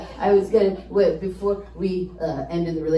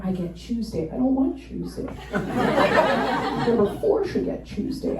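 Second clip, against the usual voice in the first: ordinary talk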